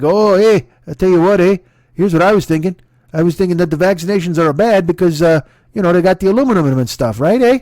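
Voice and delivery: put-on loud, jokey Canadian accent with eh; a hammed-up Canadian crank; mock Canadian accent. Canadian conspiracy guy chiming in, mock Canadian accent. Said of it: "someone in fucking Canada's like".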